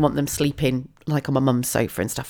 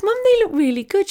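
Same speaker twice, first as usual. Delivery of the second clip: delighted; 3 dB louder than usual. Retold or imitated. Delighted at dogs.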